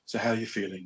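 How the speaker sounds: soft, low mock counsellor. Counsellor checking on client Jonathan, soft low voice. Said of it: "or a counsellor".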